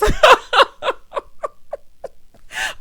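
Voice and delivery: high, loud cackling laugh. Real, not acted. Cracking up over Marceau's advice, a high loud laugh. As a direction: lose it laughing.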